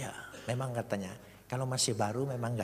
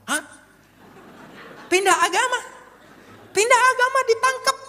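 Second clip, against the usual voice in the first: much higher, louder incredulous cry; astonished outrage; 12 dB louder than usual. Retold or imitated. Cellmate hearing he was jailed for converting, astonished. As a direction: shout it in disbelief.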